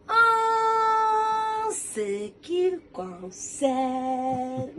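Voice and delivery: put-on very slow, high, sung Cameroonian accent; exaggerated Cameroonian melody over a long-winded phrase; singsong Cameroonian accent. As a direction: drag out the Cameroonian phrase in song. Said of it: "ils ont également tendance à chanter".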